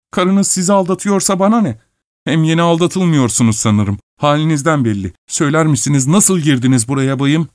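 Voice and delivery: high-pitched indignation; agitated shouting. A narrator's reading. Old man trembling with excitement at İvan, agitated. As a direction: snub him, worked up and high. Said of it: "heyecandan zangır zangır titreyerek bağırıyordu".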